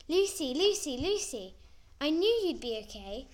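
high, loud, overjoyed calling of a name; beaming excited call. Acted. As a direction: burst out with relief and joy. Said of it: "Millie beamed at me".

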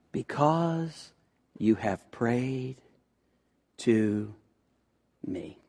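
low, soft, slow hushed words; solemn prophecy. Acted. Isaiah giving God's reply to the king, low and very soft. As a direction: let each word fall slowly and gently. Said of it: "Isaiah comes and says".

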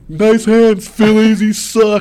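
loud, high jeering at a fumble; rowdy fans mocking a fumbled catch, loud and high; crowd heckle. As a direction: jeer him like a whole stadium.

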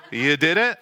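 a chuckle under a feigned question; laughing mock surprise. To his wife, feigning innocence, laughing. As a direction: play innocent through a laugh.

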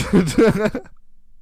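high burst of laughter. Real, not acted. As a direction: laugh out loud at your own joke. Laughing at own impression.

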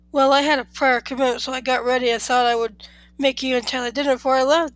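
usual speaking voice

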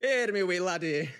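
loud, high hammed-up Scottish; Scottish accent. Actor clowning through an audition, broad Scottish accent. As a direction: belt out a comic Scottish brogue. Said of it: "can you do Scottish?".

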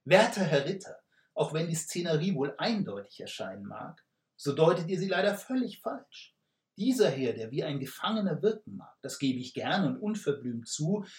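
broad smiling reply. Acted. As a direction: charm the knight with a big grin. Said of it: "entgegnete breit lächelnd".

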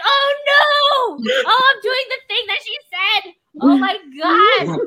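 high, loud hammed-up panic; mock panic. Realizing she's doing what the counselor said, hammed-up panic. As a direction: realise in horror and wail.

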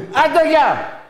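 loud, high, abrupt send-off; shouted dismissal. Real, not acted. Host recognising a repeat caller and hanging up, shouted dismissal.

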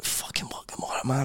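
unvoiced, barely-awake whisper; sleepy mumble. Lying in bed imagining waking up, sleepy whisper. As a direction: murmur it very softly as if half asleep. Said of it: "you're lying in your bed and you're like".

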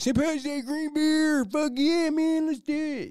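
high, loud whooping party guy; younger party self. Retold or imitated. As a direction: hype it up like a drunk reveler. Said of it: "Be like".

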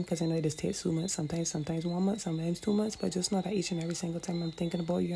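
fast, rushed, barely pausing for air; all in one breath. Real, not acted. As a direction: rattle it off in a single breath. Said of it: "I'm gonna do it in one breath".

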